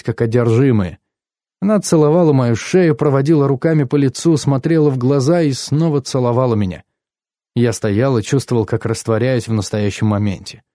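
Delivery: ordinary delivery, nothing marked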